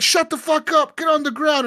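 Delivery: high barked "Shut the fuck up"; mock cop bark. Cop shouting down a begging prisoner, high mock bark.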